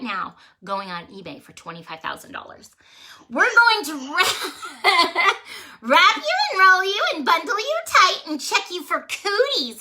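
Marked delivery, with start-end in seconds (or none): 3.3-9.8 high giggly chant broken by laughs; playful giggling taunt; giggling goddesses. Acted. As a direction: giggle through the threat.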